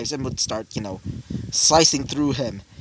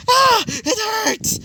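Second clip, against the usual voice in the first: high, drawn-out mock cry of pain; acted pained scream; slower than usual. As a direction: yelp it high and stretched, as if shot. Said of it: "as he would scream out".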